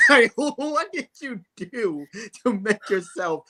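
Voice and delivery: laughing through a slow incredulous question. Real, not acted. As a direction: laugh while you ask it. Laughing while asking.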